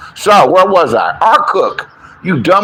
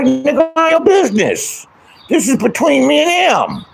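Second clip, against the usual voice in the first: dismissive retort; 9 semitones higher than usual. Retold or imitated. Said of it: "I'm like".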